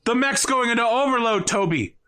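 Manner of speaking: high panicked cry; panicked shout. Acted. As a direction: shout it in alarm. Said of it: "Kenneth's just like".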